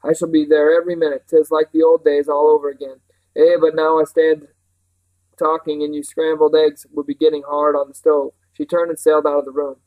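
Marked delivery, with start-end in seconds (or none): 0.0-8.3 louder, lively country housekeeper; enthusiastic Mrs Park. Acted. Housekeeper tells children about carnival day, eagerly. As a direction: tell it eagerly in a rustic voice.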